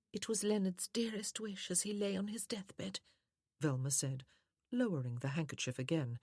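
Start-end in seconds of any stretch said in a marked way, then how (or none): 0.1-3.0 high, whispery feminine voice; soft, sweet woman. A narrator's reading. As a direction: plead sweetly through tears. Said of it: "how soft and sweet her voice was".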